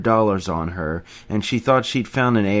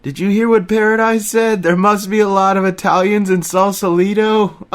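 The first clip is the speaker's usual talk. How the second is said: very high, loud excited retelling; hysterical Remy; 11 semitones higher, 6 dB louder than usual. A narrator's reading. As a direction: gasp it out, overcome with glee. Remy retelling the joke, laughing.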